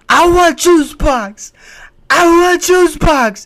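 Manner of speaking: put-on high, slow, loud toddler whine; whiny toddler. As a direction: demand the juice box like a stubborn toddler. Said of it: "their only way to protest things".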